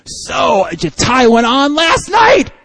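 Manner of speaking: big guy yelling high and loud; loud mocking shout; retold shout. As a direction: bellow the question across the room. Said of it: "He's like".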